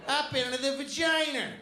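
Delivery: Boston teacher lecturing the class. Retold or imitated. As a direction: imitate a fifth-grade Boston sex-ed teacher. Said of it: "She's like".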